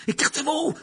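high, urgent shout. Acted. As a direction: yell at friends to stand back. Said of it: "cria-t-il à Ron et à Hermione".

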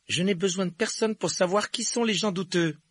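put-on high, loud, firm boy's retort; indignant cool reply; cold rebuff. A narrator's reading. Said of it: "dit-il avec froideur".

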